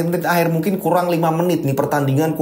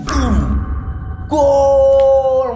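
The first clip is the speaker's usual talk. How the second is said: goal call; 8 semitones higher, 4 dB louder than usual. Acted. Opponent scores.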